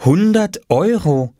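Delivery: high, drawn-out reading of a sign; reading out slowly. A narrator's reading. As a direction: read the price aloud word by word, stretched. Mama reads out the price sign.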